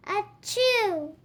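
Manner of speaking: mock sneeze. Character sneezes in story.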